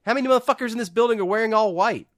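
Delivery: high-pitched, incredulous comic outburst; exasperated rant. Real, not acted. Mock exasperated rant about witness.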